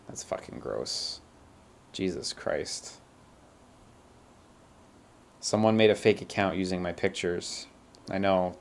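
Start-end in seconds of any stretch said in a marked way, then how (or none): 0.1-3.0 slow, hushed, disgusted; disgusted whisper. Real, not acted. Reacting to fake account, disgusted.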